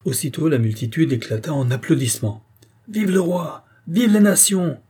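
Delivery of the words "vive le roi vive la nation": high, lifted rallying cry; acclaiming shout, pitched high; crowd cheer. A narrator's reading. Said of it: "crièrent toutes les voix".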